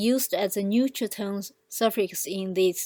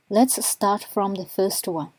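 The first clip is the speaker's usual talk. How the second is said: normal talking voice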